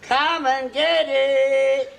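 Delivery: very slow, drawn-out resonant holler; resonant call. Acted. Old man repeating his call for the director, resonant call. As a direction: sing it out, holding the last word. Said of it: "Such resonance".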